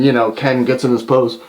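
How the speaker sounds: usual speaking voice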